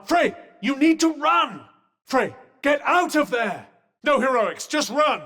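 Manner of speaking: high, urgent calling out; urgent warning. Acted. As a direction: call out high to make them flee. Urgent warning to flee danger.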